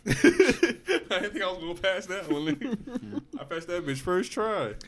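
laughing through the words, slow and high; giggling in disbelief at his own luck. Real, not acted. Friend laughing as he tells of passing math. Laughing while telling pass.

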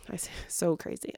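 soft unvoiced so crazy, half mocking; whispered aside. Shown as a demonstration. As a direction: whisper the words so crazy to yourself. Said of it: "I sound like a valley girl".

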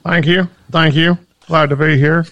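high, loud campaign-trail politician. Acted. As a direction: schmooze the crowd like a candidate. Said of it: "why do you sound like a politician all of a sudden".

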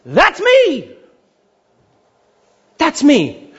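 high, bold That's me; divine claim. Retold or imitated. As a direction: declare godhood with a ringing high voice. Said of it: "a statement basically saying".

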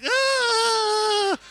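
high squealing scream; kids screaming. Retold or imitated. Kids screaming as their parents wrestle, high squeal. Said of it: "The kids are like".

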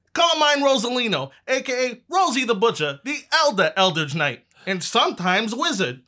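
high, pushy infomercial pitch; hard sell ad. Acted. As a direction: sell the book like a TV ad. In-character ad for magic book.

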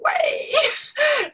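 teary laugh breaking high; half laugh, half cry, very high; laughing through tears. Real, not acted. Emotional moment recalling son.

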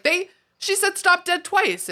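high, slow exasperated complaint. Retold or imitated. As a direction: spell it out, high and fed up. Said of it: "And I'm like".

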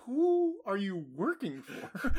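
slow, laughing mock suspicion at the doctor; mock suspicious. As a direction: accuse the doctor half-laughing. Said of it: "And I'm like".

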